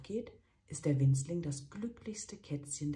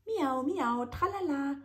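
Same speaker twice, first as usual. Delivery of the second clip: high, loud mocking singsong; mock the kitten's singsong back; mocking chef; 12 semitones higher, 5 dB louder than usual. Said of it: "schimpft der Chefkoch".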